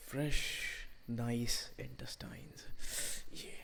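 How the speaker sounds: hushed, slow, relishing whisper; menacing whisper. Acted. Torturer admiring his victim's insides, whispered. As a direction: savour it under your breath.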